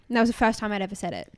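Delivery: ordinary delivery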